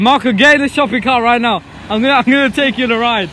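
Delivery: loud excited call; shouting. Real, not acted. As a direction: yell it across to your mate. Shouting at friend to board.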